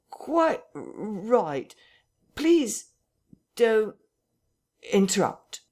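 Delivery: high, halting, jerky voice; jerking intonation. Acted. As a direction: force the words out in jerks. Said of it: "curious jerking intonation".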